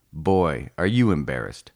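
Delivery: plain reading voice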